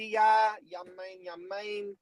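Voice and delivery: high, playful Jamaican lilt; tossed-off mock Jamaican patois; Jamaican accent. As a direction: slip into a put-on Jamaican accent. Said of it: "went Jamaican accent there".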